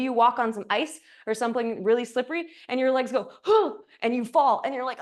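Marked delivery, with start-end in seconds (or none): 3.4-3.7 sharp, high startled gasp; sudden high "huh" of surprise. Acted. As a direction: gasp as your feet slip from under you. Legs slip on ice.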